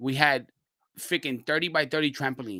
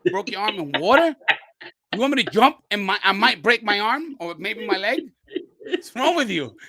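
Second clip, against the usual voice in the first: incredulous objection; 6 semitones higher, 4 dB louder than usual. Retold or imitated. Brooklyn dude balks at bridge jump.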